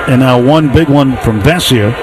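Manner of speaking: usual speaking voice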